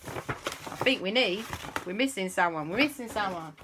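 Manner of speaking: plain reading voice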